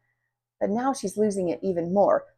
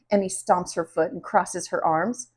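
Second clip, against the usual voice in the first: usual speaking voice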